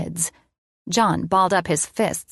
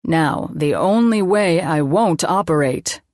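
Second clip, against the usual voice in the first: mad scientist; 4 dB louder than usual. A narrator's reading. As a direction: play villain with mock menace.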